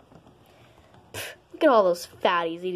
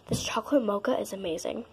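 quick breathless whisper; fast whisper; 6 dB quieter, much faster than usual. Acted. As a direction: rush it in a whisper. Fast whispered food review.

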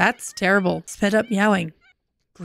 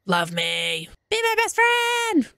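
needy plea; 10 semitones higher, slower than usual. Pretend. Voicing a needy Sim's plea.

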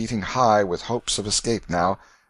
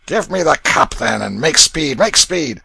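loud, impatient demand; 6 semitones higher, 8 dB louder than usual. A narrator's reading. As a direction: hurry them up. Canty impatient.

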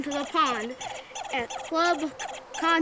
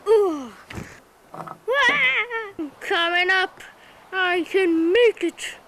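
straining climb; 6 semitones higher, slower than usual. Acted. Climbing back up the cliff.

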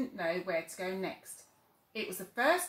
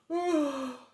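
drowsy higher sigh; sleepy sigh; 7 semitones higher, 6 dB louder than usual. Acted.

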